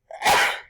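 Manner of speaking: real cough, loud and dry. Real, not acted. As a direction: cough hard mid-sentence. Coughing fit during reading.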